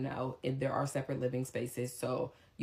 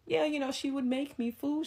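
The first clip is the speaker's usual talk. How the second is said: high whimpering plea; mock whiny lover; 12 semitones higher than usual. The man to her mom, high mocking whine. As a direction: pitch him up into a pathetic whine.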